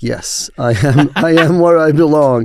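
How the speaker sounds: a laughing, rising cheer of relief; breaking into a happy laugh on it; delighted. Retold or imitated. Recalling his first school day, laughing with delight.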